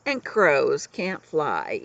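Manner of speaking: usual speaking voice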